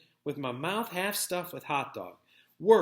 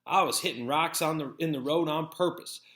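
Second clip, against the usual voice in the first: plain reading voice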